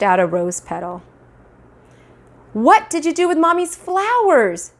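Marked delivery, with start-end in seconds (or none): 2.5-4.7 high, upset mother raising her voice; raised voice. Acted. Mother demanding answer from toddler, high raised voice. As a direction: press the toddler, getting upset. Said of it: "She raised her voice".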